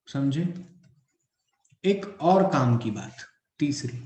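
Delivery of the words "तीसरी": ordinary talk, nothing marked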